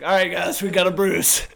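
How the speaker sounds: loud announcing call to the group. Retold or imitated. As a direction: call the name out loud to the line. Bouncer voiced calling out.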